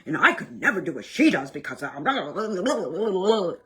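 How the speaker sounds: a self-mocking tangle of excuses; mock rationalizing. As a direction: parody your own justifying. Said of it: "we don't have to go down the rabbit hole".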